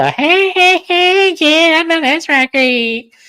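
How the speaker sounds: high hammed-up hype girl; mock girlfriend voice. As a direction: ham up her cheering him on. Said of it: "And she go".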